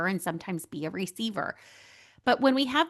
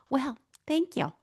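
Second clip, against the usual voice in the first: bashful thanks; 5 semitones higher than usual. Retold or imitated. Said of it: "And I was like".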